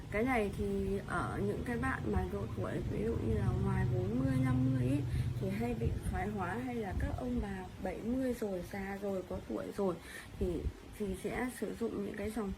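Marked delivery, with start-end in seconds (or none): none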